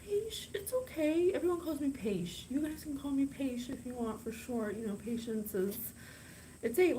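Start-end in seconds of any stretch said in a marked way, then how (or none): 0.0-1.3 cutesy. Acted. Cute nickname intro.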